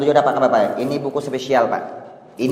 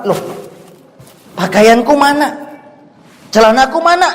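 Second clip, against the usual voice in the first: high, startled, confused questions; bewildered asking; 11 semitones higher than usual. Acted. Dead man waking in the grave, startled and confused. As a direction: sound lost and puzzled, searching.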